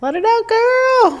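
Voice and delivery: put-on high, drawn-out "let it out, girl"; sassy cheer. Cheering friend on to cry.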